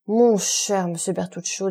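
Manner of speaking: usual speaking voice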